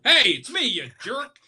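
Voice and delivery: a high, loud, mock annoyed wife; wife's retort. Wife woken by her husband's loud hello, a hammed-up retort. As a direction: snap back lightly as the wife. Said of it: "your wife doesn't go".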